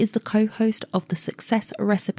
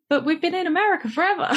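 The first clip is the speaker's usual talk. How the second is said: higher, fast mock protest; lightly voiced baffled kids; mock indignant grandchildren; 11 semitones higher, much faster than usual. Host imitating future grandchildren reading their DNA results, mock indignant. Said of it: "and they'd be like".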